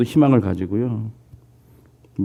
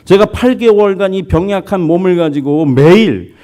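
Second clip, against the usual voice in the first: high, louder protest at God; crying out; 7 semitones higher, 4 dB louder than usual. Retold or imitated. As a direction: shout out the bitter complaint, voice high and loud. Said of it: "그러자 그가 이렇게 소리칩니다". (높고 크게 하나님께 항의하며 외침)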